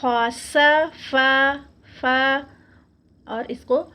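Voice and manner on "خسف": خسف with a heavy, drawn-out خ; slow, higher recitation. Shown as a demonstration. Teacher modelling a heavy kha. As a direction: recite خسف very slowly, making the خ thick. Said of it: "خا کو جو ہے ہم موٹا پڑھیں گے".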